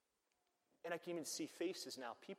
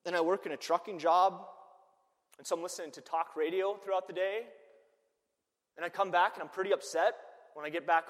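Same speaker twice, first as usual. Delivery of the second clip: worked-up dad; 6 semitones higher, 12 dB louder than usual. Retold or imitated. Voices the angry trucker dad.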